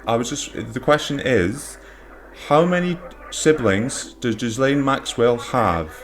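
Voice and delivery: drawn-out quiz host suspense; game show host voice. Pretend. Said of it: "I need to put on my game show host voice".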